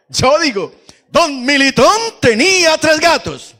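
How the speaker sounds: loud, high, drawn-out rallying shout; thundering voice. Shown as a demonstration. Leader drilling the congregation in an echo game, thundering voice. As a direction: boom the line out for everyone to repeat. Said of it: "con voz de trueno".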